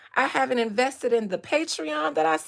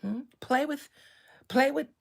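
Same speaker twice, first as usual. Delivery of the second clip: usual speaking voice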